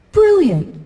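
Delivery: high-pitched mock English audience. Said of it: "I went to England".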